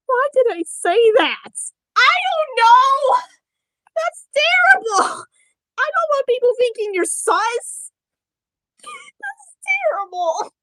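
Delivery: very high, loud wailing dismay; squealed mock despair; panicked regret. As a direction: wail it in hammed-up horror at yourself. Panicked self-reproach over word choice.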